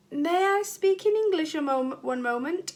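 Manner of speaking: put-on a hesitant French accent, high at the start; tentative French accent. Dennis asks teacher timidly, in tentative French accent. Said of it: "in a tentative French accent".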